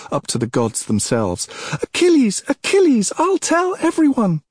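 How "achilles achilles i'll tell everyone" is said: very high, loud repeated screams; shouts. A narrator's reading. Princess threatens to tell everyone, high frantic shouts. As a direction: shout the name for the whole palace to hear. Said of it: "the princess's shouts".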